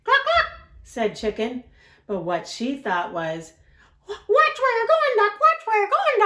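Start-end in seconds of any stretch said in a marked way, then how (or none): 0.0-0.6 very high loud clucking; chicken cluck. Acted. Chicken answering Duck, high loud clucks. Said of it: "we have to use the voices". 4.1-6.2 very high, loud panicky voice; scared chicken. Acted. Chicken afraid of Duck, high panicky squawk. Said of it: "we have to use the voices".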